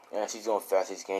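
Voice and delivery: usual speaking voice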